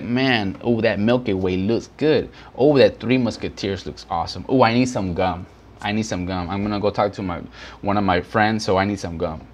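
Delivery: a shopper thinking aloud at the checkout, dropped low and soft; tempted shopper. Retold or imitated. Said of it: "people be like".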